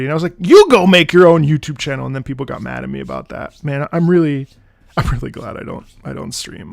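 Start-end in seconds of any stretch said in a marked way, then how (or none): 0.4-2.1 loud re-enacted snapping back; retold crash-out. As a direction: yell it as a retold comeback. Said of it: "I crashed out".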